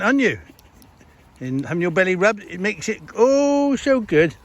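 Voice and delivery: high, loud pet talk; cooing at a dog. Real, not acted. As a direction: croon at the dog as you rub its belly.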